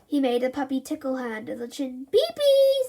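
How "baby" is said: put-on high, loud toddler babble; little girl's first word; baby talk. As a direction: say it like a tiny baby. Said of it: "said Molly".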